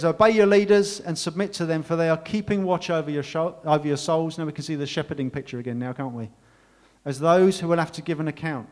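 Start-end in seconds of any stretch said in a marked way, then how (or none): 0.0-4.4 raised, emphatic Bible reading to the church; scripture reading. Acted. Pastor reads Hebrews to his congregation, raised proclaiming voice. 7.0-8.7 raised, emphatic Bible reading; scripture reading. Acted. Pastor reads Hebrews to his congregation, raised proclaiming voice.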